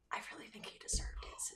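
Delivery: retold defiant whisper. Second grader whispering back to the nun, defiant whisper. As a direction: breathe it without voice, cheeky.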